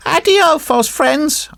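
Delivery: high-pitched puppet voice, brushing them off; dismissive marionette. Acted. Marionette rebuffs the false friends, high and dismissive. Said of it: "answered the marionette".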